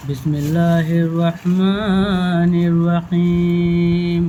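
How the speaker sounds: slow, drawn-out Quranic recitation over music. Acted. Teacher recites Bismillah and dua before the lesson, slow and devout. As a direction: recite the opening prayer slowly and devoutly.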